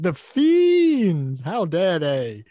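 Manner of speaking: hammy, insincere heroic indignation; Batman. Retold or imitated. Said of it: "you don't really mean that".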